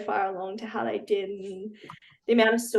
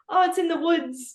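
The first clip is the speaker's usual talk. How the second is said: mock American teammate, higher and louder; imitated American accent; 8 semitones higher, 5 dB louder than usual. Teammate quoting the American player's saying, imitated American accent. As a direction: call it out high in a put-on American accent. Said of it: "her accent, like, she calls".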